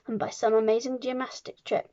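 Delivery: usual speaking voice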